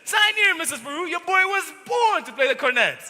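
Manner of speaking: feigned delight. Acted. Salesman hamming up delight to the Irish mother. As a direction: pounce on the news like it's a miracle.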